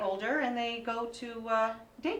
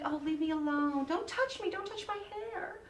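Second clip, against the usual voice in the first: put-on whiny teen voice, much higher and softer at the end; annoyed teenager; 8 semitones higher, 3 dB quieter than usual. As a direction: brush mom off like a sulky fourteen-year-old. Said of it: "she's like".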